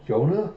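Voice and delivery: tentative rising question on "Yona"; "Yona" with an unsure upward lilt. Retold or imitated. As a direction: say "Yona" as a puzzled query, lifting at the end. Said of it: "hear someone questioningly go".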